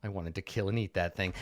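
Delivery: low, soft, mildly forlorn creature; softly crestfallen monster. Acted. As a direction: sigh it out with mild regret. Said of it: "like, forlornly".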